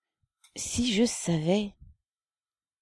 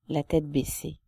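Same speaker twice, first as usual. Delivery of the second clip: ordinary delivery, nothing marked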